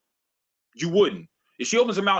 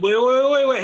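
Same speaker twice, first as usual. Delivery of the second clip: calling out; 8 semitones higher, 8 dB louder than usual. Real, not acted. Cutting in to hold the floor.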